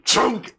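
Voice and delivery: high, sudden switch noise; spotlight clunk. Acted. Game master imitates a second light coming on, high clunk. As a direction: pop out a high mechanical clunk.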